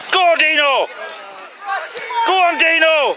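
loud sideline cheer; shouting encouragement. Real, not acted. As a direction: yell it across the pitch.

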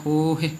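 ਹੋਹੇ with stretched first syllable; long ho, short he. Shown as a demonstration. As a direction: hold ho in ਹੋਹੇ, clip he. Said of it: "ਹੋ ਨੂੰ ਲੰਬਾ ਲੈ ਕੇ".

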